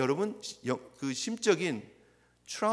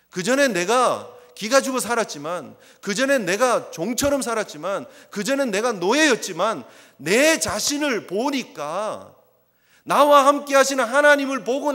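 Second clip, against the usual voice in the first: louder, building declaration; a preacher building up Jacob's resolve; 1 rising emphasis; 4 semitones higher, 8 dB louder than usual. Acted. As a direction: build each line with force. Voicing Jacob's new confidence.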